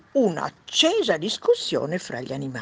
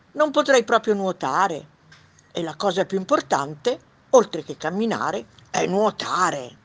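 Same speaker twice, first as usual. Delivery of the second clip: loud, higher-pitched duck voice; 4 semitones higher, 7 dB louder than usual. Acted. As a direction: pipe up loudly as a storybook duck. Duck voice in read story. (voce acuta e forte da anatra)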